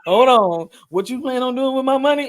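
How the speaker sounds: hammed-up stern father demanding answers; stern dad.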